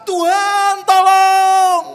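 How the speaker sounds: high, very loud cry for help; panicked scream. Acted. Pastor on runaway horse at cliff edge, panicked scream. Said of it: "berteriak spontan".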